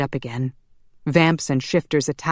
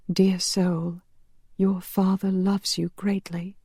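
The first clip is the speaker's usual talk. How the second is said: higher tender voice for Dear soul; tender angel, higher; 5 semitones higher than usual. Acted. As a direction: gently lift the message to the soul. Marielle delivers message to soul.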